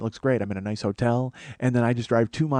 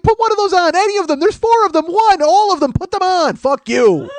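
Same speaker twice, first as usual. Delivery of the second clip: much higher, loud mocking taunt; shrill taunt; 9 dB louder than usual. Topless man jeering at debutantes, much higher loud taunt. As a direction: wave the shirts and jeer.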